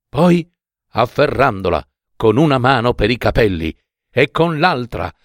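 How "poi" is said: plain reading voice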